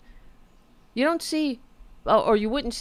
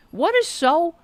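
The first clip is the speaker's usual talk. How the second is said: ordinary talk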